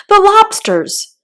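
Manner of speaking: high, loud, drawn-out shout. Acted. Gryphon cuts in on the Mock Turtle, shouting. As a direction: bound up and shout it. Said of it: "shouted the Gryphon".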